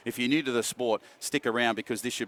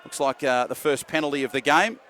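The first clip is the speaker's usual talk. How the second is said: brisk, lively match call; live play-by-play; 3 semitones higher, 4 dB louder than usual. Real, not acted. Commentator calling the match live, brisk play-by-play. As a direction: keep the call moving with the play.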